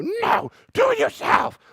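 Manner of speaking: mock the cold parent who won't help; hammed-up snappy refusal; harsh parent. Parent refuses child's request.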